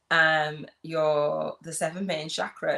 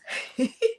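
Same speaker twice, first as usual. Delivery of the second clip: airy giggle. Real, not acted. Laughs at own joke.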